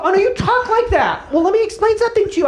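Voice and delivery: high, rushed, incredulous protest; hammed-up flustered traveler; mock exasperation. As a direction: explain in comic high-pitched disbelief, speeding up. Acted exasperation at unintelligible local.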